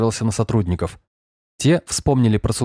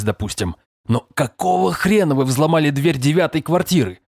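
high-pitched exasperated boss; indignant rant; 6 semitones higher than usual. A narrator's reading. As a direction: berate a subordinate point by point. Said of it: "продолжал разоряться Сергеев". (возмущённо отчитывая подчинённого)

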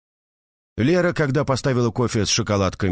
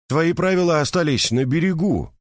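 pointed hint; 9 semitones higher than usual. A narrator's reading. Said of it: "намекнул Майк".

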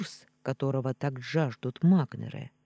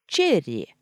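quick shout, louder; shouted name; 6 dB louder than usual. Acted. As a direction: blurt it out as a shout. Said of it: "поспешно выкрикнула картошка".

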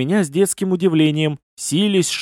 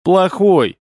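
louder, higher, emphatic scolding; stern rebuke; 5 semitones higher, 5 dB louder than usual. A narrator's reading. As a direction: snap back with a strict verdict. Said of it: "строго продолжил я свою нотацию". (строгий выговор)

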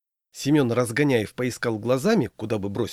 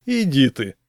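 louder, higher scoff of disbelief; disbelieving; 4 semitones higher, 6 dB louder than usual. A narrator's reading. Semyon hearing of his friend's wedding, disbelieving. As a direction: brush it off as unbelievable. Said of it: "не поверил Семён".

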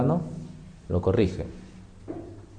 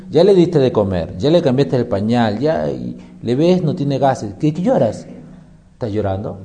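ordinary talk

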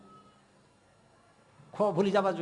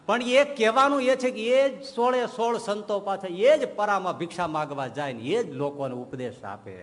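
normal talking voice

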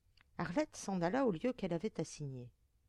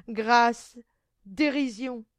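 very loud, raised scorn; derisive shout; 3 semitones higher, 12 dB louder, much slower than usual. A narrator's reading. Monster scoffing at his victim's plea, loud derision. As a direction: hurl the plea back mockingly.

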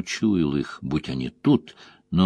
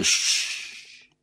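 quiet unvoiced hiss; soft shush; 6 dB quieter than usual. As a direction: hush him with a soft shh. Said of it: "Лойл издал тихое".

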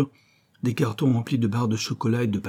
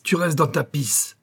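higher, slow scornful voice; contemptuous growl; 6 semitones higher, slower than usual. Acted. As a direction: spit the words with contempt. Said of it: "rugit le S S".

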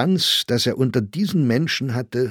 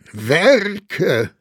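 higher, louder mocking cry; exclaiming; 9 semitones higher, 6 dB louder than usual. A narrator's reading. Grossinger exclaims at the word Werke, mocking. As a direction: call it out in disbelief. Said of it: "rief Grossinger aus".

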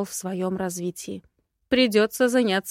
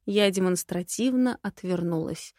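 plain reading voice

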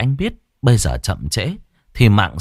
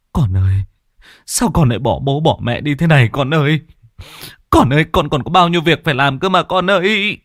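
sobbing; 5 semitones higher, 4 dB louder, faster than usual. Acted. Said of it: "gục mặt khóc lóc".

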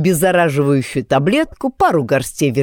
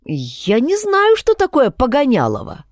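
put-on puzzled young man; confused; 7 semitones higher than usual. A narrator's reading. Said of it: "растерянно улыбнулся Майкл".

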